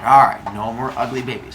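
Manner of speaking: low-pitched acceptance; resigned agreement. Acted. Father Sky grudgingly agrees.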